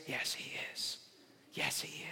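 breathy whispered yes. Real, not acted. Preacher whispering to the congregation, a hushed whisper. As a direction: drop to a whisper, intimate. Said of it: "whispered affirmation to congregation".

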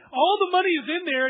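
high, loud burst of amazement; excited. Pretend. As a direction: exclaim it like you can't believe your luck. Imagining money found in trunk.

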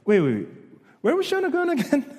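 sarcastic friend. Retold or imitated. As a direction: play the sarcastic friend pretending to forget. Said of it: "he had a sarcastic friend".

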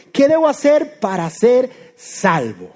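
"¿qué debo hacer para ser salvo?" dicho muy despacio, cada palabra estirada; súplica lenta. Acted. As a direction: alarga cada sílaba como un ruego. Pregunta del carcelero, dramatizada. (pregunta del carcelero, lenta y arrastrada)

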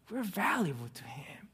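soft breathy whisper, no voice; hushed sermon aside. Real, not acted. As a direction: whisper it close to the congregation. Preacher drops to a whisper.

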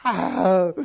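a long, loud sigh to show wordless prayer; loud demo sigh. Shown as a demonstration. Pastor shows the congregation a wordless prayer, loud sigh. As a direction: let out one big heavy sigh. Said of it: "all we have to do is just sigh".

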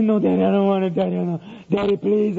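lightly voiced whimpering little boy; pleading child. Son begs his father as he is turned around, pleading. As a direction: imitate a child begging Daddy.

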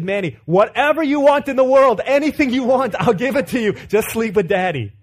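loud, high, hammed-up begging; desperate plea. Dad making last bargain with screaming toddler, loud desperate pleading. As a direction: offer everything at the top of your voice.

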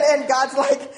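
ordinary talk, nothing marked

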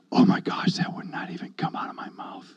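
voiceless, hushed disbelief; amazed whisper. Retold or imitated. Man to himself after hitting his thumb, amazed whisper. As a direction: whisper it to yourself, stunned. Stunned that no curse came out.

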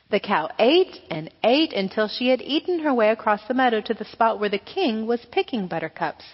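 ordinary delivery, nothing marked

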